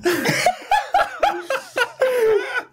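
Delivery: shrieking giggle, out of control; a high laugh that can't be stopped; high squealing laugh. Real, not acted. Giggling fit over panda name.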